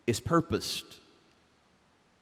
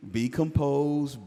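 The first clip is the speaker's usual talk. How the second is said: ordinary delivery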